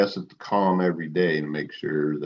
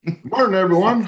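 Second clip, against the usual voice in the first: announcer greeting; 12 semitones higher, 5 dB louder than usual. Acted. Podcast host's opening greeting.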